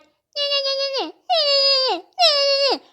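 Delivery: very high, drawn-out mock squeal; mocking voice imitation. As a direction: mimic someone's voice to ridicule it, high and whiny. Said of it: "talking about how she can't stand my voice".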